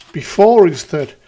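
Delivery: loud, disbelieving repeat of the line; incredulous outburst. Real, not acted. Streamer rereading the nacho clip to chat, loud and incredulous. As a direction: blurt it out in disbelief.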